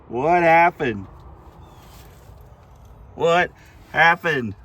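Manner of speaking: loud shocked yell. Real, not acted. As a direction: shout it out in real dismay. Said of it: "Sorry, I didn't mean to yell on the camera".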